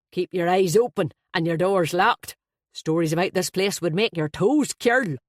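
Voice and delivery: a flustered, jovial Dubliner blurting out; Irish best man. Acted.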